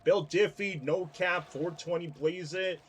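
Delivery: hammed-up Zoomer slang; mock Zoomer talk, tongue in cheek; ironic zoomer. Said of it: "Talking like a Zoomer now".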